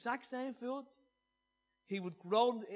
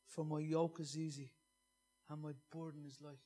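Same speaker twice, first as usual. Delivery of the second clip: gentle scripture reading; 4 semitones lower, 5 dB quieter than usual. Acted. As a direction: read it like a quiet invitation to rest. Reads scripture over music.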